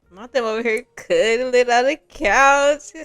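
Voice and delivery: high, loud teasing squeal, drawn out; high-pitched mock-scandalized gushing. Real, not acted. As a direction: gush slowly and loudly over the lovebirds. Gushing over couple cuddling.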